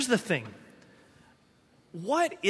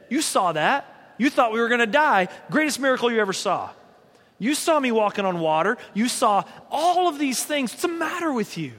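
higher, exasperated scolding, hammed up; mock chewing out; 4 semitones higher than usual. Jesus pretending to chew Thomas out, hammed-up exasperation. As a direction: play the impatient scolder, not the real Jesus. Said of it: "And he's like, like".

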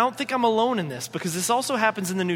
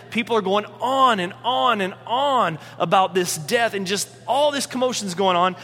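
ordinary talk

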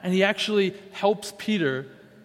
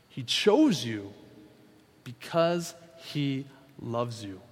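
tender, soft; 5 semitones lower, 3 dB quieter, slower than usual. Real, not acted. Tender key line of sermon.

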